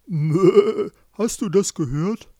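loud, wobbly bleat into speech; bleating ram. A narrator's reading. Ram comments to the sheep, loud bleating voice. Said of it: "aber nur der Bock verstand".

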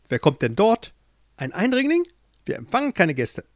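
high, haughty queen demanding who's there; suspicious queen. Acted. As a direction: turn the stranger away coldly. Queen challenges intruder.

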